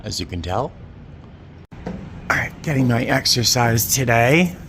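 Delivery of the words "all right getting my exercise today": straining and grunting on a climb; breathy effortful climbing. Real, not acted. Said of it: "Sorry for all the grunting".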